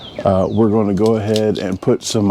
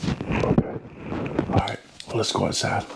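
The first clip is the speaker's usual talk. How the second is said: whispering; 9 dB quieter than usual. Real, not acted. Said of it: "so I have to whisper right now".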